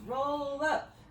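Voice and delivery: normal talking voice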